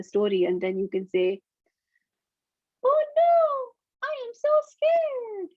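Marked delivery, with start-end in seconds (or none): 2.8-5.5 very high, squeaky little mouse voice; scared mouse. Acted. Reading a story to children, a frightened mouse in very high squeak. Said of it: "the mouse was speaking in a really high pitch".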